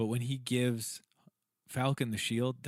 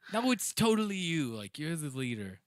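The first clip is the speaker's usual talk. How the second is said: mock Thor; 4 semitones higher than usual. Thor insisting Valkyrie leads.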